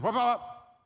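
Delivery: loud, high "wop" sound effect; three punchy mock whacks of the voice; imitated snatching noise. Preacher acting out God taking away a desire, loud imitated noise.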